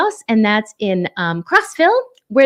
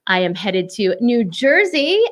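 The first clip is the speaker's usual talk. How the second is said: ordinary talk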